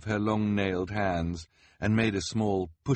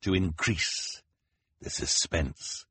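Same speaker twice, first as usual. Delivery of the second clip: slow, drawn out for suspense; slow, hushed showman tease; very slowly; slower than usual. A narrator's reading. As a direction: stretch each word to tease. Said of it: "very slowly".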